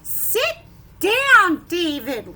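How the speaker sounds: teacher ordering a pupil to sit, loud; sharp order. Acted. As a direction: snap the order at him. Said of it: "all those exclamation points".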